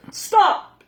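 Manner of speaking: high, loud stop; shout. A narrator's reading. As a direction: cut everyone off with a shout. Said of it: "shouted Bear".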